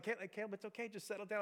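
put-on gently calm an upset kid; soothing dad. Dad soothing frustrated child.

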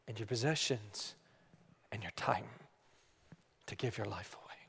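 low, soft, solemn plea; quiet, grave appeal, trailing almost to nothing; hushed imploring. Real, not acted. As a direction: drop down low and implore them, pausing between phrases. Solemn drop, imploring congregation.

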